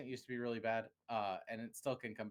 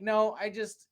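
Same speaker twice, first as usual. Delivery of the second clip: defensive outburst; 8 semitones higher, 7 dB louder, slower than usual. Retold or imitated. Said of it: "And I'm like".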